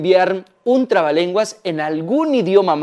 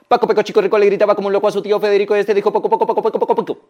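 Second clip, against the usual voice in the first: breakneck tongue-twister patter; very fast, run together, nearly tripping; super fast; 3 semitones higher, much faster than usual. Shown as a demonstration. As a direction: race through it until the words blur. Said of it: "Lo decimos súper rápido".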